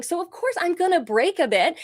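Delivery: normal talking voice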